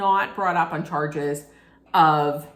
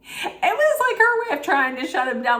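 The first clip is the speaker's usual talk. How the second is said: excited; 10 semitones higher, 3 dB louder, faster than usual. Real, not acted. Animated commentary on shutting down.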